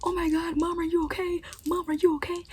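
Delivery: high, frantic mom voice; panicked mom. Retold or imitated. Daughter imitating her mom fussing over grandmother, high and frantic. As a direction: mimic a panicked mother, pitched high. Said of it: "And my mom was like".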